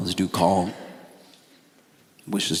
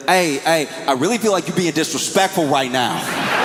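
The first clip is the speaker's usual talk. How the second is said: offended friend; 7 semitones higher than usual. Retold or imitated.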